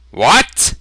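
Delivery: exclaiming. A narrator's reading. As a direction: jolt out the word in disbelief. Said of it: "exclaimed Garrow".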